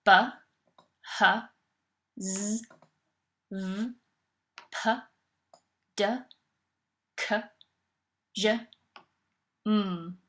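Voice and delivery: each letter as its bare sound, not its name; isolated speech sounds. Shown as a demonstration. Lecturer demonstrating sounds a child cannot hear, isolated speech sounds. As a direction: sound out B, h, z, v, p, d, k, j, m one by one, long pauses between. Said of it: "without the following sounds".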